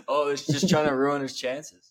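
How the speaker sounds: normal talking voice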